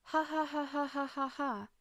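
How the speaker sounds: high, eerie giggling ha ha; children's laughter. Acted.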